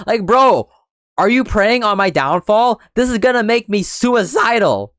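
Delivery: outraged disbelief. Real, not acted. Reacting to his backup-plan jab, outraged disbelief. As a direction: hit back in real outrage.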